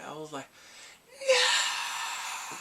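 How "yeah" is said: long, loud, very high shriek; high wailing scream. Retold or imitated. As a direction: let out a drawn-out wail of shock. Acting out shocked reaction.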